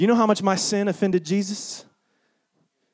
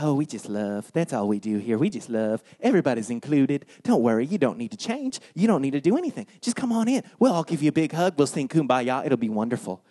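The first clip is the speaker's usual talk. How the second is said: mock hippie Jesus; 4 semitones lower than usual. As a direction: lull it out like a mellow hippie. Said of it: "He just went around like".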